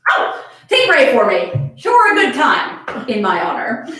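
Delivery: slow, grand mock solemnity; mock dramatic. Reading Mina's fake deathbed wish, slow mock drama. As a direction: drag it out theatrically.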